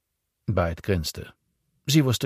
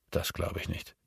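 murmured; 9 dB quieter than usual. A narrator's reading. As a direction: mutter it to yourself. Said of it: "murmelte Byte".

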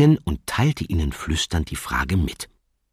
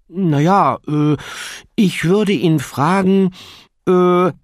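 put-on high, slow, halting child voice; hesitant young dragon; 8 dB louder, slower than usual. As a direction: stammer it out like a shy youngster.

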